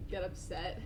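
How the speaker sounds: ordinary delivery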